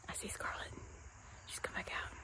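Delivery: hushed aside off camera; whisper. Real, not acted. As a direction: mom whispers an aside while filming an outro. Quiet aside mid-outro.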